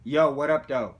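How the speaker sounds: loud friendly hello; loud greeting. Real, not acted. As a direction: call out big over the phone. Calling out on the phone.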